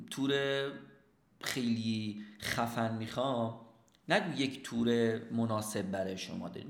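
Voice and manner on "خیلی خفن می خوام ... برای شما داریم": ordinary talk, nothing marked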